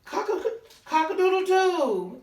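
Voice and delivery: loud, high crowing rooster call. Acted. Teacher crowing for the barn rooster, loud and high. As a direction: crow it out like a proud rooster.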